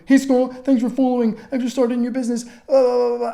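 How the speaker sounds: mock spammy pitch. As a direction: parody of a pushy cold-message seller. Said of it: "Don't send this massive chunk".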